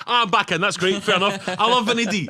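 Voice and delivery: loud, thrilled and high; excited. Real, not acted. Thrilled at D'Onofrio news.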